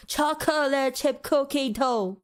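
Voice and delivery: "chocolate chip cookie dough" slowly, beat by beat; laboured syllables. Shown as a demonstration. Mocking the laborious name.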